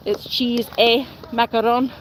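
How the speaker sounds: cheese e macaron, with e said as "ay", the Italian-American way; Italian-American accent. Retold or imitated. Recalling her nana's Italian-American word in that accent. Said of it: "my nana used to call it".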